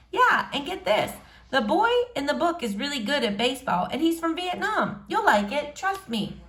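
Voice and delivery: put-on shy girl greeting a boy she likes.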